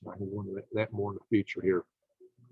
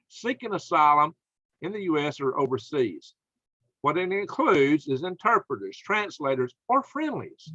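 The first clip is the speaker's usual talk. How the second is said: higher-pitched, incredulous outrage; real indignant anger; 5 semitones higher than usual. Real, not acted. Host venting to viewers about Americans left stranded, angry and incredulous. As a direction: rant in disbelief, pitch climbing on the questions. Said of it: "I'm mad".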